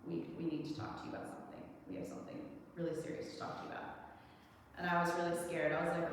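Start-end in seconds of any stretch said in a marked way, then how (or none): 0.1-3.8 hushed and fast, like breaking grave news; serious hushed talk. Retold or imitated. Parents sitting their son down, hushed and serious. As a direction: parents leaning in with grim, quiet urgency. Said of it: "sat me down in a really serious way".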